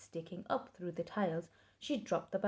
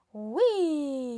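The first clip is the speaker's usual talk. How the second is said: hen's shriek; 7 semitones higher, 10 dB louder than usual. A narrator's reading. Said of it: "shrieked the hen".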